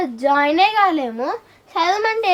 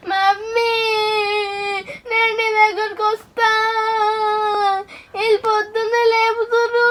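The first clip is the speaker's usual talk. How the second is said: crying; 3 semitones higher than usual. Acted. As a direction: wail for mother, stretching each phrase end. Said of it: "ఎందుకు ఏడుస్తున్నావు". (సాగదీస్తూ ఏడుపు)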